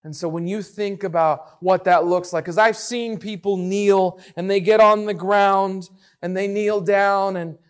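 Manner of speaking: ordinary delivery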